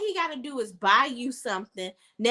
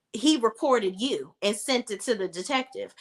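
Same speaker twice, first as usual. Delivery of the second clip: ordinary talk, nothing marked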